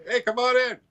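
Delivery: high, bright-pitched doorman welcome; lightly put-on friendly greeter voice; mock welcome. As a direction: play a cheery doorman waving him in. Mock welcome from venue staff.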